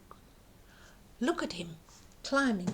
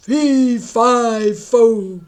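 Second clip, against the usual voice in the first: falling giant's roar; loud booming giant cut off; 11 dB louder, much slower than usual. Acted. Giant falling down the beanstalk, booming. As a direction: boom as he tumbles.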